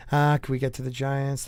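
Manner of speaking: normal talking voice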